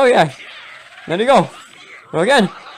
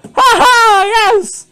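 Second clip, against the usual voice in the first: very high, loud laugh and cheer; exultant high shout; triumphant; 5 dB louder, much slower than usual. Acted. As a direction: cackle in victory. Triumph in game.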